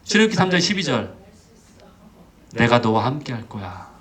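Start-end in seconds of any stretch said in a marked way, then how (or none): 2.5-3.9 low, steady promise; reassuring. Acted. God answering a discouraged Moses, low and reassuring. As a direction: reassure calmly in a lowered pitch.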